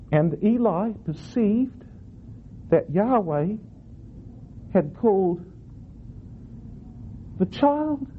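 put-on halting, suspended build to a high "child"; slow, broken phrases climbing to a high, astonished "child"; stress on CHILD. Shown as a demonstration. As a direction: pause before "the child", lifting "child" in dawning disbelief. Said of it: "this now is the right emphasis".

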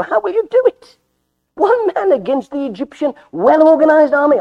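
high, loud disbelieving questions; incredulous challenge. Acted. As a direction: challenge him with voice shooting up. Jethro challenges Moses's plan.

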